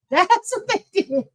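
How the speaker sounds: laughing through the words. Real, not acted. Streamer laughing to chat about skipped pauses. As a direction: let the laugh spill into it.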